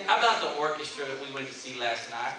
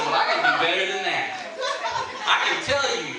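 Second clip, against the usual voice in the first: laughing reply; 5 semitones higher, 8 dB louder than usual. Retold or imitated. Retelling his playful reply.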